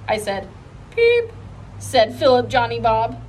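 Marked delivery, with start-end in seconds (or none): 0.9-1.3 very high and louder squeak; loud peep. A narrator's reading. The toy monkey joining in with a loud high peep. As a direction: belt out one high chirp. Philip Johnny Bob peeping.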